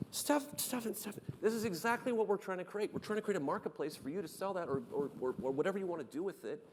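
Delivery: high-pitched, eager retold outburst; excited. Founder pitches marketplace to engineer Stefan, excited. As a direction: burst in excitedly, pitched well above normal.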